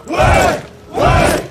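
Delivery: high, loud rallying shout; crowd cheer. Acted. Rebels answer their leader, roaring shout. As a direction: roar it back as one crowd.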